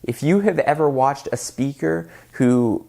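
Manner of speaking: ordinary talk, nothing marked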